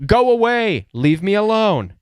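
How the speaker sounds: high, forceful shout of go away through the door; firm go away. Shown as a demonstration. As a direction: slam the boundary shut with your voice. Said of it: "a voice through the door saying".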